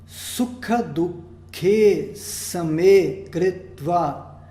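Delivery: sukha dukhe same kritva spaced out very slowly; slow Sanskrit demo. Shown as a demonstration. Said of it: "E o último exemplo".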